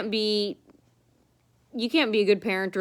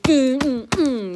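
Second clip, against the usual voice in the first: imitated hand slamming; 5 semitones higher, 6 dB louder, much slower than usual. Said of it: "like that".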